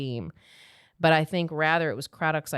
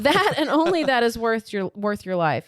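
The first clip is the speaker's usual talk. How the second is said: higher, louder acted protest; insistent heroine; 7 semitones higher, 6 dB louder than usual. A narrator's reading. Rissa answering Morrigan about his people, insistent. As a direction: read her reply with raised, urgent insistence. Reads Rissa's heated reply, continued.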